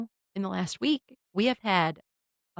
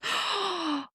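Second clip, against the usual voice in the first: put-on toddler gasp; 10 semitones higher, 3 dB quieter than usual. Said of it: "And she goes".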